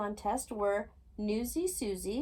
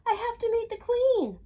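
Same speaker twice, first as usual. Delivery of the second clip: high, loud, urgent cry; cried out; 13 semitones higher, 8 dB louder than usual. A narrator's reading. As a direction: plead to get back, high and loud. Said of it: "Lizzie cried".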